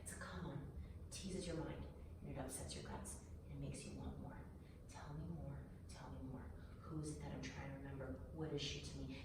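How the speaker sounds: very soft and low; hushed, haunted musing. Acted. Woman warning partner how one word teases the mind, hushed. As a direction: draw the listener into private, obsessive questions. Imagining the tempting inner questions.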